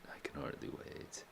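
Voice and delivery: "I can hardly wait" whispered, breathy and hushed. Shown as a demonstration. Echoes the line in a whisper.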